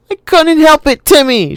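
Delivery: put-on high, loud wailing girl; girl shrieking at her brother in misery; miserable shriek. A narrator's reading. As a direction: shriek it out miserably, like a scared child. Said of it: "she shrieked miserably".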